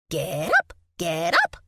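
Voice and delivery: excited little critter squeak; squeaky squirrel voice. Acted. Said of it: "Nutty squeaked".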